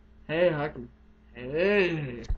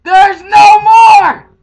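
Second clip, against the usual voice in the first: slow, high, loud protest; shouting; 7 semitones higher, 10 dB louder, much slower than usual. Real, not acted. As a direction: drag out the refusal. Refusing to continue.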